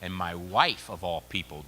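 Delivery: ordinary delivery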